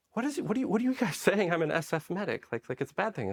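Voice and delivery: high, rushed, laughing confusion; baffled. Retold or imitated. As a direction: sound genuinely thrown by the reaction. Acting his own confusion.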